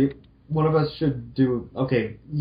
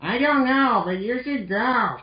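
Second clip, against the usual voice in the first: put-on higher, bright girl-robot voice; cheerful Roll voice; 12 semitones higher than usual. Roll greeting Mal cheerfully in a higher voice. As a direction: play sweet Roll greeting her friend. Roll's voice.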